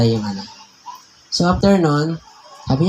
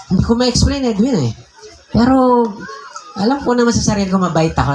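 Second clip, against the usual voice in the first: higher, earnest retelling of the friend; friend confiding; 6 semitones higher than usual. Retold or imitated. Pastor retelling the friend's confession, higher and earnest.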